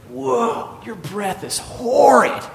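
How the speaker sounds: high, drawn-out mock disgust; disgusted father. Father to the chaplain about his breath, disgusted. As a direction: recoil in exaggerated horror, stretching the words.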